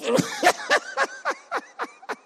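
loud, high burst of laughter; hearty laugh. Real, not acted. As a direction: laugh out loud at your own joke. Joking about wives questioning husbands.

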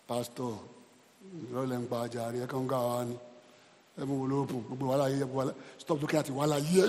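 soft, low skeptical complaint; a quiet Nigerian moan about trouble; doubtful grumble. Retold or imitated. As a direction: grumble your doubts at the pastor. Doubting congregant voiced in Yoruba.

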